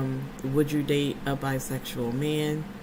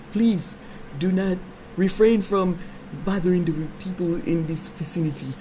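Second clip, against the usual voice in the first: mock African accent. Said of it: "Eddie Murphy had to take over".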